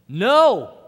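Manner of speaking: loud, high shout of no. Retold or imitated. The other voice refusing temptation, shouted. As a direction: cut the temptation off hard. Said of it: "another voice comes in".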